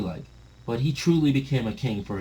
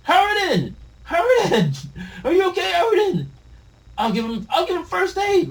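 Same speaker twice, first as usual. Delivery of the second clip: much higher, loud panicked cries; frantic calling; 6 dB louder than usual. Acted. Friends rushing to beaten Harold, frantic calls. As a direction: cry out for the hurt boy.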